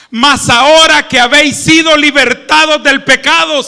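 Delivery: fervent preacher reading; preaching scripture. Acted. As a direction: proclaim the verse to the congregation. Reading scripture at full force.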